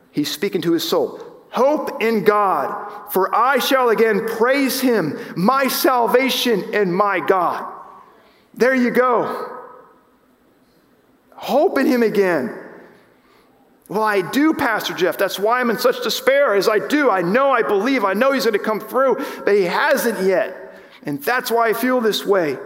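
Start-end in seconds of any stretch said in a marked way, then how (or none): 1.5-12.5 high, emphatic scripture proclamation; ringing sermon exhortation; preaching. Acted. Preaches scripture at full force. 13.9-22.6 higher-pitched, insistent objection; acted-out plea to the pastor; pleading protest. Acts out a despairing congregant's protest.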